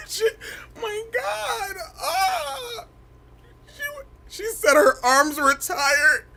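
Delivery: very high squealing laugh; shrieking laughter. Real, not acted.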